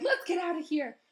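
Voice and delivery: high, loud urgent plea; nervous urge. Acted. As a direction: push them to leave now. Ron scared wants to flee.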